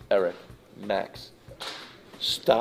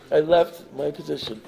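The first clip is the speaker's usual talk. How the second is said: ordinary talk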